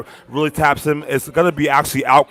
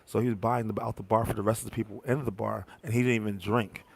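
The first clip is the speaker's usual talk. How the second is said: hushed, much softer gossip; whispering; 2 semitones lower, 12 dB quieter than usual. Real, not acted. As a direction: drop to a secretive hush. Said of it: "Why are you whispering?".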